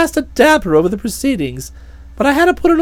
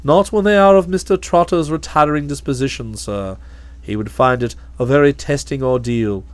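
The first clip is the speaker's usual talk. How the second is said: low, calm, deferential butler voice; measured and unruffled, much lower; a low, imperturbable manservant; Jeeves the valet; 13 semitones lower, 5 dB quieter than usual. Acted.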